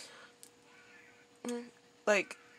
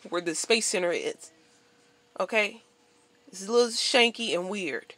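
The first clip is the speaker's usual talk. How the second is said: ordinary talk, nothing marked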